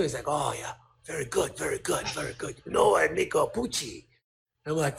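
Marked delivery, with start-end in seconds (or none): 0.3-4.1 put-on Japanese accent. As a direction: play an approving Japanese businessman, accent put on. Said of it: "here's my Japanese accent".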